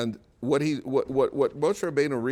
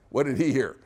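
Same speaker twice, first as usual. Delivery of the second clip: ordinary delivery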